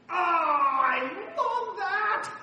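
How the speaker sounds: drawn-out, high cry of delight. Real, not acted. Preacher to the church, overjoyed at the story. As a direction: savour the moment slowly, voice lifting.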